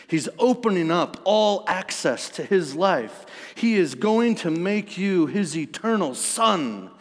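fervent sermon climax; impassioned preaching. Real, not acted. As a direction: build the promise with rising pitch and force.

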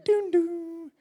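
high sung two-note "doon, doon"; station announcement chime. Retold or imitated. Preacher imitates the station screen's jingle, high mock chime. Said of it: "this angelic voice comes on the screen".

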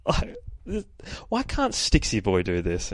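normal talking voice

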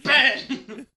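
laughing "Bang!" sound effect; a playful shouted bang; mock gunshot.

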